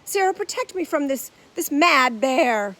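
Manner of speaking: high, loud theatrical plea; dramatic plea. A narrator's reading. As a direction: plead melodramatically, slowing on mad bear. Actor pleading dramatically.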